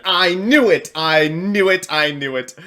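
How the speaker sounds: loud high triumphant cry, dying down each time; excited shout fading. Real, not acted. Streamer proving his read right, triumphant shout fading to plain. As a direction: yell it, then let it settle. Called win prediction comes true.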